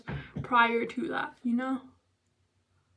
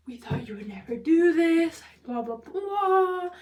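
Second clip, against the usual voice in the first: louder mock parental scolding; furious parents, imitated; 4 semitones higher, 7 dB louder than usual. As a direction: retell the parents' outrage, raising your voice. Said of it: "like, furious".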